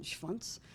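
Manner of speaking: Schwanz with an initial "shv" sound; German pronunciation. Retold or imitated. German man explaining a number plate, Schwanz in German. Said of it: "means in German".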